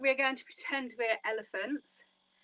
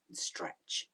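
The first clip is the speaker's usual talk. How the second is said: soft whispered cue; 9 dB quieter than usual. Shown as a demonstration. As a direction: breathe the word out in a whisper. Guided breathing, stretching arms up.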